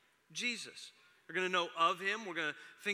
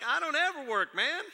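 raised, louder teasing voice of the son; mock son's teasing; 8 semitones higher, 7 dB louder than usual. Pastor imitating son ribbing him about working, higher and louder. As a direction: tease like a cheeky kid, lightly.

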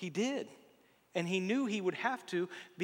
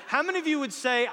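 excited call out; 9 semitones higher, 8 dB louder, faster than usual. Real, not acted. Rousing the congregation.

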